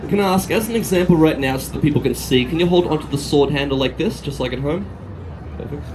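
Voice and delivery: usual speaking voice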